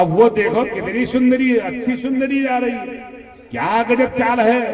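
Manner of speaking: high-pitched mock admiration; excited exclamation. Companion pointing out the approaching woman to Bhagwan, excited.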